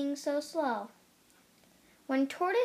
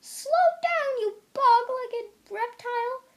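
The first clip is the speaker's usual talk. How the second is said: scream; 10 semitones higher, 7 dB louder than usual. A narrator's reading. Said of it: "screamed Hare".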